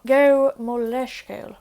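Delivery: slow it down for learners. Shown as a demonstration.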